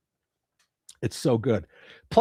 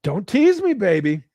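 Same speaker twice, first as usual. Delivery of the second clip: high playful mock plea; playful tease; 5 semitones higher, 4 dB louder than usual. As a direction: tease back coyly, pitched up.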